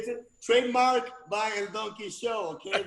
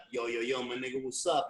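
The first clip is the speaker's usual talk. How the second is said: low, soft Compton street greeting; 8 semitones lower, 5 dB quieter than usual. Acted. Showing how one talks in Compton, low mock greeting. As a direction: drop low into an imitation street hello. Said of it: "if we're in Compton".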